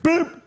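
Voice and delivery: high imitated beep of a phone going dead; phone cutting out. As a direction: chirp the sound of the line dying. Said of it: "It goes dead".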